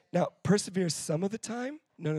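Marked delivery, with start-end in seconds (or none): none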